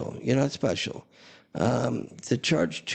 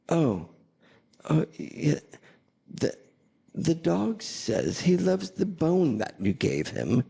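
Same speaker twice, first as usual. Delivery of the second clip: lightly imitated English accent, higher and slower; 7 semitones higher than usual. Pet psychic passing on what the dog says, mock English accent. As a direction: put on a hint of an English accent, drawn out. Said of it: "she said in this English accent".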